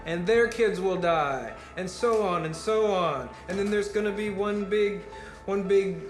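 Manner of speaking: doomsday rant. Acted. Speaker tells the crowd everyone will die, ranting loudly. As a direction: hammer each disaster home to the crowd.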